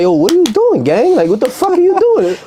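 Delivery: high, climbing outraged yelp; mock outrage. Coming home to find friends asleep, high outraged yelp. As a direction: pitch it up in disbelief at the sleeper. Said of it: "and I'm like".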